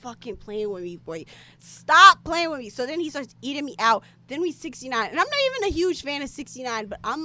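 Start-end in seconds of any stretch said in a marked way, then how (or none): 0.0-1.2 hushed warning. Acted. Reacting to his line, hushed. 1.6-2.7 emphatic warning. Acted. Same reaction, now loud.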